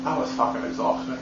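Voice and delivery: whispered, barely voiced brag; whispered aside. Real, not acted. After signing off, a whispered aside. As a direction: toss it off under your breath.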